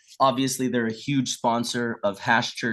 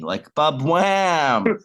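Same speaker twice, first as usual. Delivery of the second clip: slow, drawn-out ba-wham, louder; mock crash; 4 semitones higher, 5 dB louder, much slower than usual. Telling how the old guy keeled over, slow hammed-up crash. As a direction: stretch the crash out like a falling tree. Man falling over like tree.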